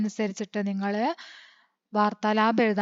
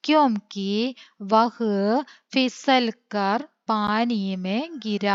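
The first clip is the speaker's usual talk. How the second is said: slow dictation; much slower than usual. Shown as a demonstration. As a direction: read out slowly, word by word, for copying. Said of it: "ഇനി അതിൻ്റെ answer ആണ് എഴുതാൻ പോകുന്നത്". (പതുക്കെ, വാക്കുവാക്കായി dictation)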